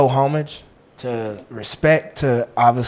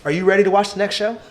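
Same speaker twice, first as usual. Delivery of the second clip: mock announcer; 6 semitones higher, 5 dB louder than usual. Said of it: "I rehearse my shout-outs".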